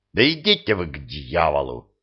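low, sulky muttered curse; offended grumble. A narrator's reading. Mazowiecki cursing those who mocked him, offended grumble. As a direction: grumble it with hurt pride, voice dropping. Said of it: "обиженно проворчал Мазовецкий".

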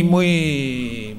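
usual speaking voice

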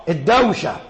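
ordinary talk, nothing marked

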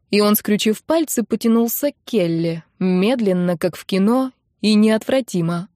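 plain reading voice

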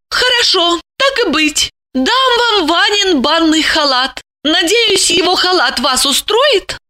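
high woman's voice, giving in; reluctant concession. A narrator's reading. Lipochka reluctantly offers a bathrobe, giving in. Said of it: "Слегка поборовшись с собой".

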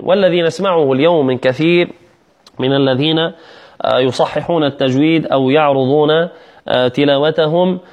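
usual speaking voice

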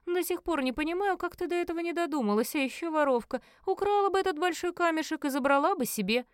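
high-pitched, mocking jibe; smirking. Brother taunts the thief sister who missed it, smirking. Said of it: "усмехаясь".

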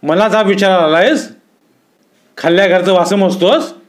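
high, indignant challenge; flaring anger. A narrator's reading. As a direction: lash out at an upstart. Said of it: "रागानं भडकन म्हणाले".

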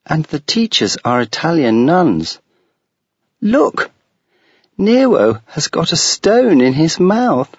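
put-on a high, eager five-year-old girl; a young child chattering about her dog; little girl voice. Child talks to the narrator.

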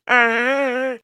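very high drawn-out scream; high howl of pain; pained yell. A narrator's reading. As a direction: cry out long and high. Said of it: "с его воплем".

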